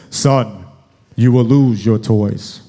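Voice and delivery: low, loud, slow pronouncement; mock-grand dad, deep and deliberate; wise father's decree. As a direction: pronounce it like a voice from heaven. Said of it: "in my wisdom from heaven, I spoke".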